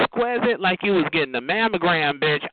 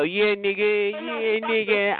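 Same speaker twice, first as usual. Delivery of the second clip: put-on thug voice, slightly higher; mock little thug; 4 semitones higher, slower than usual. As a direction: imitate someone pretending to be tough. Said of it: "try to pretend you a little thug".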